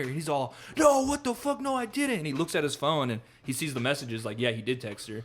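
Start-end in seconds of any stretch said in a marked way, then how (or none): 0.8-2.2 high, frantic mock denial; retold freak-out. Retelling the man denying he texted her, mock freak-out. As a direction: deny it in a high imitated panic. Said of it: "freaking out".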